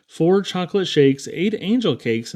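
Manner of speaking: usual speaking voice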